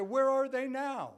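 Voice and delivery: loud, high, slow question; pointed challenge. Retold or imitated. Counselor challenging a rehab client, loud and pointed. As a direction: press him hard with the question. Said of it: "And I said".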